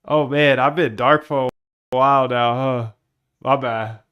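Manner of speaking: loud exclamation. Real, not acted. Streamer owning up to being dark a while, loudly. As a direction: call it out across the room. Exclaims at having been away.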